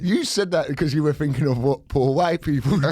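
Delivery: teasing through a laugh; laughing tease. Real, not acted. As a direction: laugh while poking fun.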